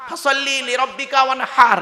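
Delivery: ordinary talk, nothing marked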